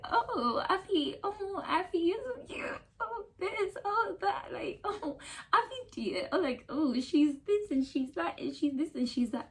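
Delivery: high, sugary gushing voice of his friends. Retold or imitated. Boyfriend's friends fussing over Afi, high gushing coo. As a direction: coo over her like an adoring fan.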